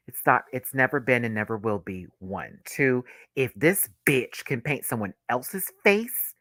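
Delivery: normal talking voice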